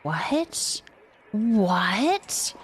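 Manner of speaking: usual speaking voice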